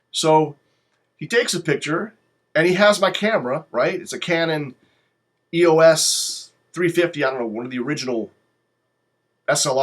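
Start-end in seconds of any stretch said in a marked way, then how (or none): none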